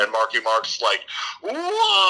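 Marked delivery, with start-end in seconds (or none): 1.4-2.1 high wailing mock cry; mock anguished why. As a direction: wail it like a bad actor. [name] anguished wail.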